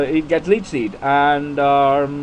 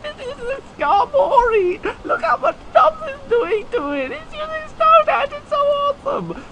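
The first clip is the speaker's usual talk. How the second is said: very high squealing mock-fan voice; hammed-up shrieky impression of a gushing gamer; excited Nubs; 4 dB louder than usual. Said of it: "Nubs impression when he gets excited".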